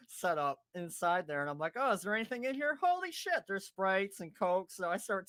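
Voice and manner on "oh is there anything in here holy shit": quick, high question rising into amazement; retold excited discovery. Teller finds drinks in a stranger's cooler, excited retelling. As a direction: re-enact a delighted find.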